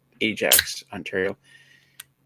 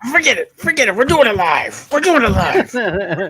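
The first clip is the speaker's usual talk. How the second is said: mock rant; 9 semitones higher, 4 dB louder than usual. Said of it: "quoting the famous "we'll do it live" rant".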